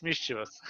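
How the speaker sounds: "mischievous" accented on its first syllable; stress on first syllable. Shown as a demonstration. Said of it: "the accent is on the first syllable".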